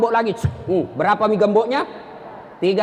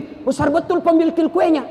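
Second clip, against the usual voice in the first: acted wonder, higher pitch; amazed; 5 semitones higher than usual. Naive rich man gaping at the mall lift, amazed. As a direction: marvel like a naive villager.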